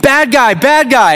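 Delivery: high-pitched mock kids' yell of alarm; kids screaming. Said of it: "they just scream".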